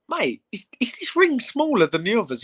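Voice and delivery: very high squeaky disbelief; mock shocked high voice. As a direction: squeal it like you can't believe your eyes. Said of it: "And I went".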